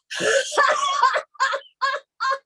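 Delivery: high staccato cackle exhales; laughter breath. Shown as a demonstration. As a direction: push out staccato laughs until empty. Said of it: "I'm gonna do a big laughter breath".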